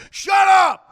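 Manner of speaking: high shouted command; imitated Levy yell. Host imitating Levy screaming at the dog, high shout. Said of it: "and then Levy's like".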